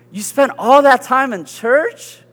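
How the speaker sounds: mock a stunned friend's disbelief; incredulous friend. Friend after church meal, amazed at his church time. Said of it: "And the person says".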